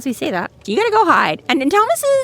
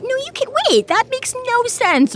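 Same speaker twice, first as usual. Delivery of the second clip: flustered realization. Retold or imitated. Employee realizing the boss made no sense, high flustered squeak.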